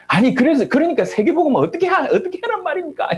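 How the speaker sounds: high-pitched, agitated imitation of an angry protest; mock-angry challenge, pitched well up; angry retelling. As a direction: act out a frustrated friend's outburst. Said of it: "화를 내면서".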